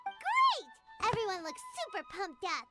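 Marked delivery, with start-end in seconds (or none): 0.0-0.7 high, excited exclamation; thrilled little shout; delighted cheer. Acted. Cheering the finished plan.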